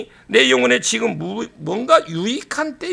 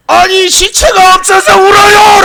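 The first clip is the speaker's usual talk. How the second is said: much higher, much louder desperate cry; anguished shout; 8 semitones higher, 10 dB louder than usual. Shown as a demonstration. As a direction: shout it out like a grieving Mary. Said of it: "라고 그래야지". (울부짖듯 크게 외치는 마리아)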